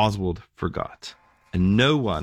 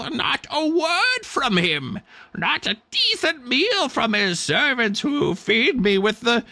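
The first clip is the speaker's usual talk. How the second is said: much higher, slow croaking bird voice; indignant raven; 14 semitones higher, slower than usual. Acted. Sulking raven answers the angel with wounded pride. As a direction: squawk out the injured pride of a neglected raven. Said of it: "the raven turned a yellow eye".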